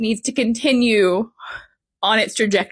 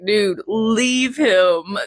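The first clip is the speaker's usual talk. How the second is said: put-on drunk slur; much slower than usual. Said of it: "when they get drunk".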